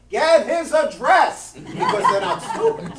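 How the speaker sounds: high, loud laughing delivery; punchline broken by laughter. Real, not acted.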